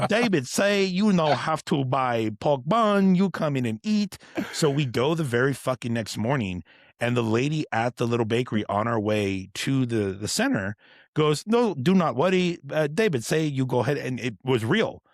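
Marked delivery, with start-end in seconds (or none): 0.1-4.2 high, loud, slow imitation of a Chinese accent; joking Chinese-accent impression; mock Chinese accent. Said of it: "any racist impression X makes of". 11.5-14.0 high, loud bakery-lady impression with a Chinese accent; lightly put-on Chinese accent; mock Chinese accent. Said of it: "any racist impression X makes of".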